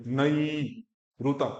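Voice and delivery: नई रुता slowly, separated and drawn out; broken apart. Shown as a demonstration. Teacher splits नूतनैरुत into pieces slowly. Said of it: "एक एक बोलो".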